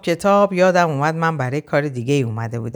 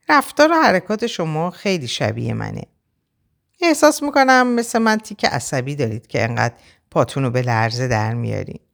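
plain reading voice

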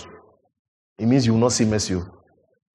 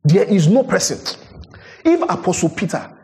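higher, louder, rising fervour; fervent preaching; 8 semitones higher, 3 dB louder than usual. Acted. Pastor pressing the church on hypocrisy, fervent and rising. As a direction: build to the question who are we.

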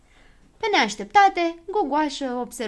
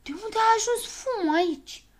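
high-pitched bewildered question; startled cry; 12 semitones higher than usual. A narrator's reading. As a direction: cry out, baffled. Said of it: "începură să strige cu toții".

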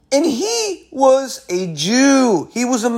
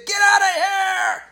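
mock shouting woman; 11 semitones higher, slower than usual. Retold angry receptionist.

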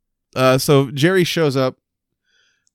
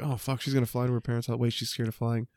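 hushed panicky muttering; 12 dB quieter, faster than usual. Retold or imitated. Writer realizing the continuity mistake, hushed fast mutter. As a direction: rattle it off under your breath, much softer.

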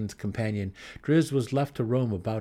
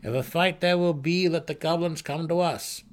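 leader's order; 8 semitones higher, 4 dB louder than usual. Acted. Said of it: "he decided at length".

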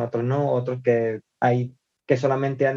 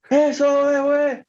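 much higher and louder stage shout; crowd hype; 12 semitones higher, 5 dB louder, slower than usual. Retold or imitated. Singer firing up the audience at a gig, loud high hype shout. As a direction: pump up the crowd. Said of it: "Eso sí, yo llegaba".

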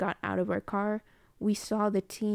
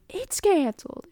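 repeated friend impression; 9 semitones higher, 5 dB louder than usual. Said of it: "only thing I have for impression".

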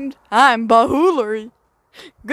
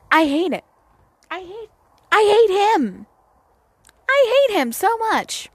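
high-pitched, exasperated whine of hatred; exasperated venting; 9 semitones higher than usual. Real, not acted. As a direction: vent in a much higher pitch. Venting about her father.

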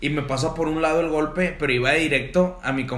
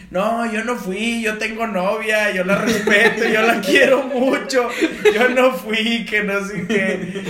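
high, slow whiny pleading; drunk guy pleading; 6 semitones higher, 5 dB louder, slower than usual. Retold or imitated. Drunk friend whining his innocence, high and slow. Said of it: "el vato empezó a decir".

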